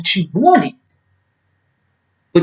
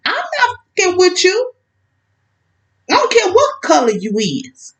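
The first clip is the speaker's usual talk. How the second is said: indignant; 8 semitones higher than usual. Real, not acted. Angry rant at the ex.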